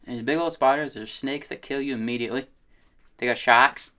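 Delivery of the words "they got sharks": louder mock Aussie accent on "sharks"; Australian accent. Listing Australia's dangers to a friend, mock Australian "sharks". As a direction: say "sharks" Aussie-style, dropping the R.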